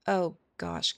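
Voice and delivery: usual speaking voice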